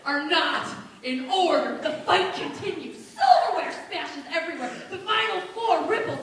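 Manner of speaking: ordinary talk, nothing marked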